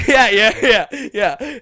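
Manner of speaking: high, loud, laughing yeah yeah; laughing dismissal. Retold or imitated. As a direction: laugh her off, slowly. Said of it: "she was like".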